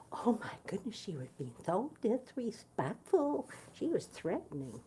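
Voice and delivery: high, mocking voice of the aunt playing victim; the aunt's prim, indignant mock voice; mocking Doris. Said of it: "that's where Doris took this".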